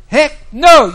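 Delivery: exasperated parent bursting out; emphatic refusal. Retold or imitated. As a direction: slam the door on the request. Parent refusing child, acted.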